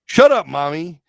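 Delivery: high, loud bratty snap; bratty yell. Acted. Brat yelling at her partner, high and loud.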